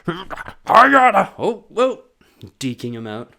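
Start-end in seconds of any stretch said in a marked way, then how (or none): 0.1-2.0 put-on high, loud, slow old man strain. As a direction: push it out like a frail old man.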